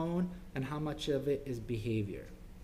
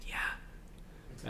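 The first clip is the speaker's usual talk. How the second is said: a tiny whispered yes; child whispering agreement; 9 dB quieter than usual. Retold or imitated. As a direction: breathe the answer without voice. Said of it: "she said".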